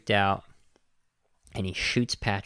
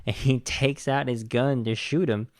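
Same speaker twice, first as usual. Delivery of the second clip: usual speaking voice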